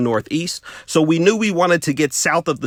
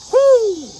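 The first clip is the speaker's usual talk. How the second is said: whoo; 4 dB louder than usual. Acted. Exclamation mid story.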